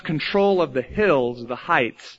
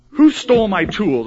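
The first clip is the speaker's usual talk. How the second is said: mock indignant complaint; 5 semitones higher, 6 dB louder than usual. Acted complaint about lost tools.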